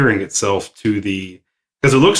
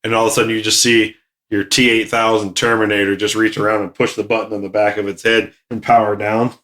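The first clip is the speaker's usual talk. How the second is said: ordinary talk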